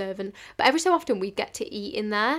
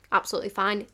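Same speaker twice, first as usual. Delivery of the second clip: ordinary talk, nothing marked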